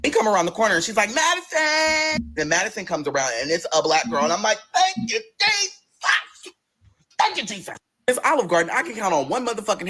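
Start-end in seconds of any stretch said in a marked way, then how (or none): 1.1-2.3 high, stretched call. Retold or imitated. Bernadette calling Madison over, stretched high call. 4.7-7.8 rejoice like a church shout; relieved praise. Retold or imitated. Relieved gratitude.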